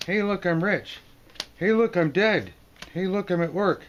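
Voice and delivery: high, loud cheery announcing; excited card figures. Acted. Card figures announcing their fortunes, high and excited. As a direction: call out each news brightly and high.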